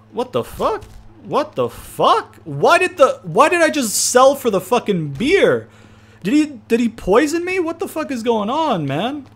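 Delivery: high, incredulous yelps of disbelief; stunned disbelief. Real, not acted. Gamer shocked his wrestler got spat on, high disbelief. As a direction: react like you just got poisoned. Stunned by opponent's spit move.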